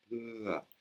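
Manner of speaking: "peu à" said on a low level Thai tone. Shown as a demonstration. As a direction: drop "peu à" low and keep it flat. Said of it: "avec une intonation basse".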